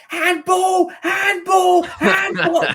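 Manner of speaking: very high, loud mocking shriek; shrieked playground accusation, imitated at full pitch; screaming kids. Kids on the soccer field calling out a foul, screaming. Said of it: "was screaming".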